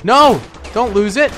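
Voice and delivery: high, tense cheering on; urgent pleading. Real, not acted. Urging team as objective slips.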